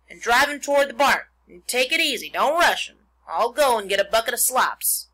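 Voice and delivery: loud, urgent-sounding farm instructions; farmer giving orders. A narrator's reading. Mister Zuckerman telling Lurvy how to catch the pig, loud orders. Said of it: "said Mister Zuckerman".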